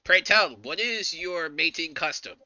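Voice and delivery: loud, high opening, easing into a slow question; orc lady yelling. Acted. Orc lady yelling at the boy about mating customs, hammed-up yell. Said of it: "she yells at Noah".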